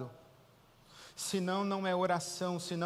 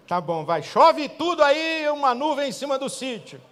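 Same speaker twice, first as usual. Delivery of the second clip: God loudly giving in to the woman's prayer; exasperated God shouting; 10 semitones higher, 12 dB louder than usual. Retold or imitated. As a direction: bellow it like God giving in, fed up. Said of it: "Deus ouve aquela mulher e fala assim".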